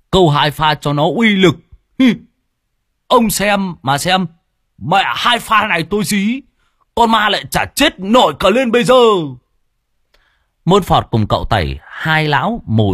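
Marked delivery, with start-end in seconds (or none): 0.1-8.9 cocky, higher and louder bragging; boastful quick reply. A narrator's reading. Lâm boasting to the homeowner about his trick, cocky. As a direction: show off to the worried homeowner. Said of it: "Nghe chủ nhà hỏi thì vội đáp".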